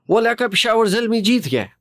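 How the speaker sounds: Urdu line in a high Pashto accent. Shown as a demonstration. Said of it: "ये Punjabi accent है या Pashto accent है".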